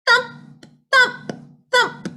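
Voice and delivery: high, short separate thumps; three high thumps with gaps between; rabbit thumps. A narrator's reading. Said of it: "a rabbit makes a thump".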